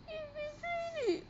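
high, small crying voice; tearful hurt. Real, not acted. Vlogger telling Casey of the betrayal, tearfully. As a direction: squeak it out softly through tears.

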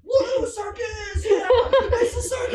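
high, slow laughing cheer; excited cheering. Pretend. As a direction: whoop like a rowdy crowd. Cheering audience member.

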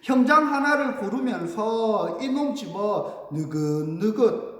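slow, drawn-out verse; measured pansori-style declamation; leisurely recitation. Acted. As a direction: stretch the words as if stalling. Recites stalling guards' deliberate slowness.

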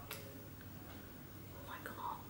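slow, breathless whisper of awe; stunned whisper; awed whisper. Real, not acted. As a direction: barely breathe it out in wonder. Awed at Quidditch ticket.

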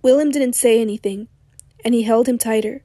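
usual speaking voice

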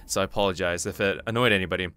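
ordinary talk, nothing marked

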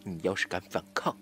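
menacing, pitched well down; low threat. Acted. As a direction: warn the captive in a low voice. Captor threatens victim. (低声威胁)

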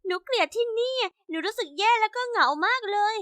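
put-on a lonely orphan girl telling her woes, mildly tearful; tearful little girl. A narrator's reading. Lonely orphan confiding in the older girl who found her crying, mildly tearful. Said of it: "เธอก็ร้องไห้อยู่จริงๆอ่ะ".

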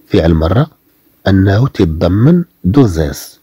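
ordinary talk, nothing marked

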